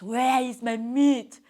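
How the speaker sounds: loud, slow lion voice; booming storybook lion wanting to be fed; demanding lion. A narrator's reading. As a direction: stretch it out as a hungry king's demand. Lion demands food from hare.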